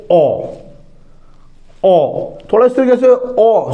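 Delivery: "ओ" drawn out slowly with a rising tune; rising short o. Shown as a demonstration. Teacher modeling the vowel O for students, slow and rising. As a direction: let the "ओ" climb upward, slowly. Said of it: "sound ऊपर की तरफ जाता है हमारा".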